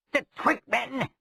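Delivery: high, terrified gasp of a name; terrified cry. A narrator's reading. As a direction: cry out in terror. Rogue cries in terror.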